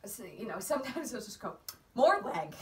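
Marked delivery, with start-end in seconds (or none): 1.9-2.5 loud, raised-pitch instruction; imitated coaching call. Husband coaching the rider from the ground, imitated loud call.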